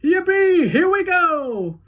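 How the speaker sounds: very high, loud whoop, then slow; thrilled shout. A narrator's reading. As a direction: ride the drop with delight. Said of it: "And Grandpa Joe shouted".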